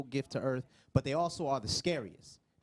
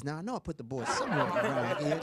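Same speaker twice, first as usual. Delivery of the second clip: bumbling dad. Retold or imitated. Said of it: "they look like they lost a pair of keys".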